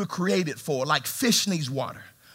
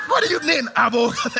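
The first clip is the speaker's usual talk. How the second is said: high, puzzled, laughing question; mock confusion; 7 semitones higher than usual. Preacher mocking the rap line, baffled. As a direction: ask it baffled and amused.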